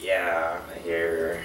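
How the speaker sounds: low, soft, sleepy mumble; half-asleep reply. Retold or imitated. Man woken at night handing over a condom, sleepy reply. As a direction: imitate answering barely awake, low and quiet.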